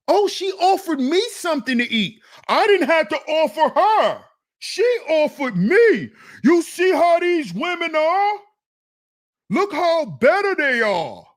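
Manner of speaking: high, bragging impression of a smitten man; mock excited guy. Mocking a passport bro bragging about his date. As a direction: imitate a naive guy gushing over his date.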